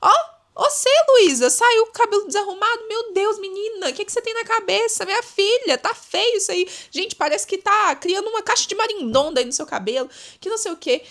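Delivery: high, loud, sneering busybody voice; envious friend mocking Luísa's hair, high and loud; mocking envious gossip. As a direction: talk down at Luísa's messy hair in a shrill-pitched mock. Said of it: "a pessoa invejosa falando".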